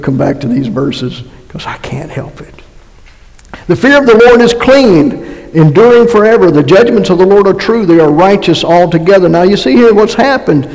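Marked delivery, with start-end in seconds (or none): 1.5-2.5 soft, half-whispered confession; hushed aside. Real, not acted. Preacher confiding to congregation about favourite verses, hushed aside. 3.7-9.3 higher-pitched, emphatic verse reading; scripture reading. Acted. Preacher reading a psalm to the congregation, emphatic proclamation.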